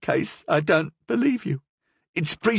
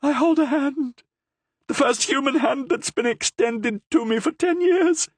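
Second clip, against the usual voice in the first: high, ecstatic cries; cried out. A narrator's reading. Prisoner crying out over the Cardinal's hand, high and ecstatic. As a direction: bless the hand in wonder. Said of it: "she cried".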